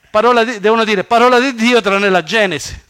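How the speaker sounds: loud, high mock liturgical announcement; mock proclamation. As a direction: proclaim it loudly with sarcastic emphasis. Mocking how they should proclaim it.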